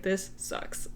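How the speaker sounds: whispered "This sucks" under breath; muttered complaint. Retold or imitated. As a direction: grumble it almost silently. Retold grumble after long wait.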